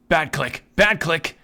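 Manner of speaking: loud, high scold of a bad click; scolding shout. Real, not acted. Gamer yelling at his misclick, loud scolding. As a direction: bark it at the mouse like a naughty pet.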